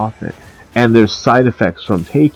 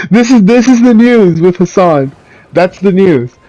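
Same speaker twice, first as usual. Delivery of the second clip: hammed-up news anchor, higher and louder; mock newsreader; 8 semitones higher, 5 dB louder than usual. Mocking Hassan as headline news, higher, louder anchor voice. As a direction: announce it like a fake breaking news bulletin.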